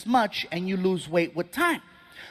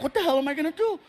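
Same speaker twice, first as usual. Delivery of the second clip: mild mock wail, higher pitch; hint at tearful despair with a raised pitch; retold crying; 6 semitones higher, much faster than usual. Patient alone in the car, lightly acted crying. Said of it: "I'm crying. I'm like".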